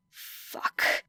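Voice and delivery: breathless whispered fuck; a whispered curse, panicking on stage. Acted. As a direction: curse it under your breath. Muttered curse under breath.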